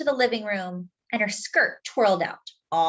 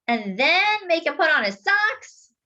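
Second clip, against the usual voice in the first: wheedling sister; 9 semitones higher, 3 dB louder than usual. Acted. Sister suggests a compromise to Dad, high and coaxing. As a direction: try to strike a bargain. Jessie bargains.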